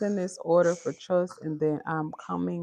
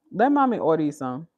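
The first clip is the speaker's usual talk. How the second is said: usual speaking voice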